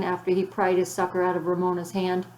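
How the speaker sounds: usual speaking voice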